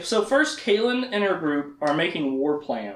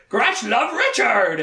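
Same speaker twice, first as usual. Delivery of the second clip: creature voice; 7 semitones higher, 4 dB louder, slower than usual. Retold or imitated. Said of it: "He can't actually talk that much".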